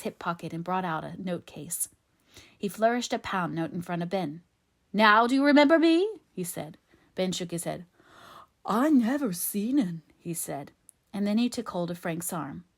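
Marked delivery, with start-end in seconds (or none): 4.9-6.1 loud, high, rising challenge; demanding. A narrator's reading. Favell waves money, demands an answer. 8.6-10.0 slow, higher, louder dialect voice; simple man insisting he knows nothing; frightened simpleton Ben. A narrator's reading. Ben's simpleton voice.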